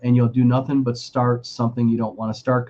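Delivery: ordinary talk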